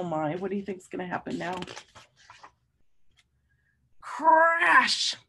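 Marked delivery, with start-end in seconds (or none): 4.0-5.2 dramatic storybook crash; big crash. A narrator's reading. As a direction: boom it out like a falling tree. Tree falls on dinosaur.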